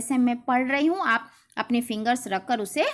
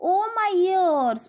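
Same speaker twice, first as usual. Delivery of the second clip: alarmed rabbit; 6 semitones higher, 4 dB louder, much slower than usual. Acted. Rabbit fretting in story.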